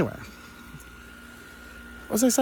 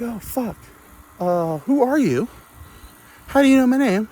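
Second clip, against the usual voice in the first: mock startled; 10 semitones higher than usual. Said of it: "I'm like".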